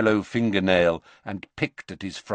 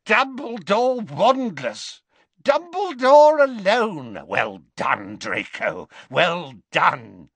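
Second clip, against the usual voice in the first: much higher and louder, slow gleeful crowing; gloating Death Eater; 9 semitones higher, 4 dB louder, slower than usual. Acted. Amicus to Draco, crowing over Dumbledore. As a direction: crow it like a giddy bully.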